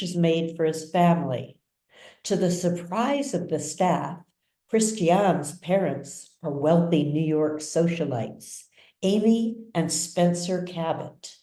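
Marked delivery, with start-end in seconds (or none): none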